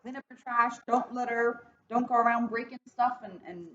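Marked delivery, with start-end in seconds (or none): none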